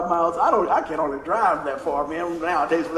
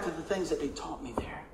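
breathy whispered aside; 4 semitones lower, 13 dB quieter than usual. Real, not acted. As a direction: whisper it to yourself. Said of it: "whispered line".

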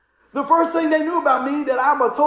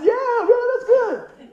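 much higher, eager agreement; a giddy, pitched-up "yeah man"; excited high reply; 12 semitones higher, 5 dB louder, much slower than usual. Retold or imitated. Pastor to his friend, answering high and eager.

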